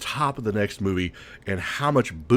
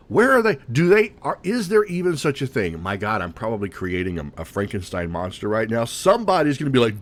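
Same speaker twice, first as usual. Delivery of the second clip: normal talking voice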